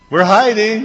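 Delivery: put-on high, loud little-kid reply; piping up like a kid who thinks he's hidden; childish hiding voice. A child answering from the closet, high and loud.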